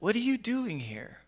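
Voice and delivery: "what are you doing here" with a drawn emphasis; emphatic question. Acted. Preacher voices God questioning Elijah, "what are you doing here" said with emphasis. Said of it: "I'm feeling with that emphasis".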